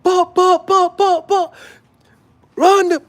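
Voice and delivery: very high, loud dancehall-style Jamaican calls; mock Jamaican shouting. Vlogger playing the Jamaican coming through, mock Jamaican shouting. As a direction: fire off Jamaican hype shots.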